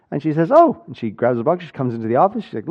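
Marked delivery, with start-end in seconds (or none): 0.5-0.8 surprised oh. Retold or imitated. Wife delighted at boxes.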